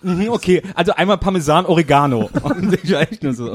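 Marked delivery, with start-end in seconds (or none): 0.0-2.3 mock employee. Retold employee's correction.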